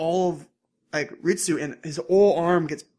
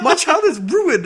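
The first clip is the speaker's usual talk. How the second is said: much higher, louder, slow dramatic lament; mock wail; 10 semitones higher, 9 dB louder, slower than usual. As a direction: wail it like a melodramatic parent. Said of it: "He's like".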